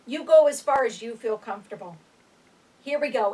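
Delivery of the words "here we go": ordinary talk, nothing marked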